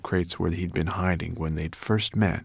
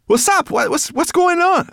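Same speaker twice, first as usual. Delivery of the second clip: high, loud stammering alarm; flustered and out of breath; 8 dB louder than usual. Acted. Brownie arriving breathless, stammering in alarm. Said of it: "Out of breath".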